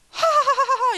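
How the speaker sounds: loud high evil cackle in a possessed voice; demonic cackle. Retold or imitated. The demon inside her laughs at the pastor, loud cackle. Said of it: "이렇게 막 웃으면서 마귀가".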